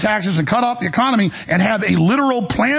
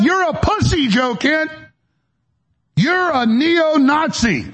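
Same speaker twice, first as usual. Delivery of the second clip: higher, sarcastic indignant jabs at Joe Kent; mock outraged insults; 7 semitones higher, slower than usual. Host parroting critics' insults at Joe Kent, mock outrage.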